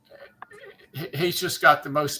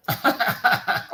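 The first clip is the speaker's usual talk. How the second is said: breathy embarrassed chuckle; 10 semitones higher than usual. Real, not acted. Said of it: "love your accent".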